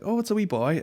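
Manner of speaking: high-pitched cooing announcement; imitated woman's delighted reveal; announcing midwife. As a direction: lift it up high, like presenting a newborn.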